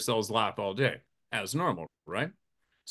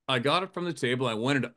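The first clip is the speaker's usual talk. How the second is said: ordinary talk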